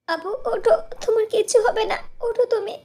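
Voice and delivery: high, frantic cries for help over a fallen sister; panicked high-pitched calling; desperate pleading. Acted. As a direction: beg her to wake up, voice climbing high.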